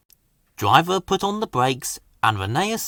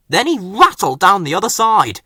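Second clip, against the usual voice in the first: usual speaking voice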